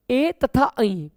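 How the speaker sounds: ए and ऐ stretched out very slowly; drawn-out vowels. Shown as a demonstration. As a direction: sound out ए, then ऐ, long and slow. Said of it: "अब इनको समझने के लिए आप ये समझ लीजिए". (बहुत धीरे खींचकर ए तथा ऐ का उच्चारण)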